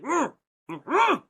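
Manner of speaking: rising, urgent closed-mouth mm-mm; urgent warning hum. Retold or imitated. Friends signalling her to stop, urgent rising hum. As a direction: hum a frantic no with lips shut. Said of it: "looking at me like".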